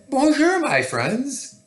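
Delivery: high, lilting cheerful hello; sing-song storybook lion greeting; happy lion greeting. A narrator's reading. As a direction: call it out high to little friends. Lion greets the sparrows brightly.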